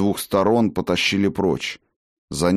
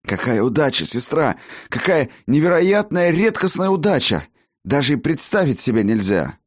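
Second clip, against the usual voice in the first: excited; 8 semitones higher than usual. Acted. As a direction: burst out in elated wonder. Healer exults while fleeing.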